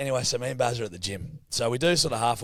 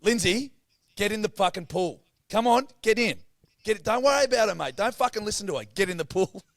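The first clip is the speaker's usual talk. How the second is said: coaxing a hesitant friend in, pumped up; egging him on; 8 semitones higher than usual. Retold or imitated. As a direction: hype your mate into the pool. Urging mate, loud high.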